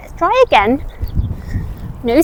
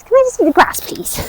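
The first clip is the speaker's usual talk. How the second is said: voicing the horse; 8 semitones higher than usual. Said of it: "He says".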